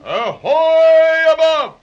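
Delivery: slow, high, loud hail upward; calling out. Acted. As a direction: stretch the call up to the deck. Hailing ship deck from below.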